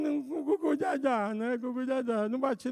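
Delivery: put-on baby babble. Child switches to baby talk.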